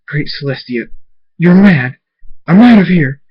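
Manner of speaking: rising into high, loud panic; frightened thug running off; panicked. Acted. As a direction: bolt in terror.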